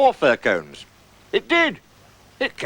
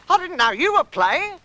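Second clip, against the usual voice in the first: very high little voice; high-pitched childlike remark; Roo. Acted. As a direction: pipe it up like a little one. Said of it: "said Roo".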